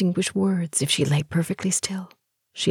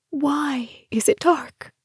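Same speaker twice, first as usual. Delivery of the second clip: a slow, high-pitched scream inside her head; screaming thought; 11 semitones higher, slower than usual. A narrator's reading.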